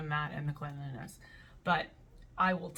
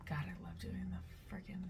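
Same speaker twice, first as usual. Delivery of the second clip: hushed aside, all breath; a giddy whispered aside; 12 dB quieter than usual. Real, not acted. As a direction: whisper it to yourself. Aside about her accent bit.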